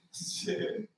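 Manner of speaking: ordinary talk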